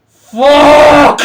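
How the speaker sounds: high, loud single curse; frustrated yell. Retold or imitated. Gamer losing his game: high loud curse. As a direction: blurt out the frustration. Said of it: "That's the reaction".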